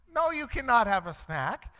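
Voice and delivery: high-pitched, incredulous mock refusal; exasperated parent. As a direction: refuse like a dad at wit's end.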